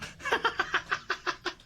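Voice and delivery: long high-pitched laughing fit; real laughing fit. Real, not acted. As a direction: burst out in helpless laughter. Bursts out at the broom.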